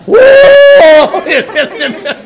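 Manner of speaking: loud, high braying whoa through a laugh; mule bray. Retold or imitated. Mule braying as the race starts.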